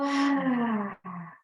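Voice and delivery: long, falling sigh of release; audible sigh. Shown as a demonstration. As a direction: let the whole breath go out audibly. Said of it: "with an audible sigh".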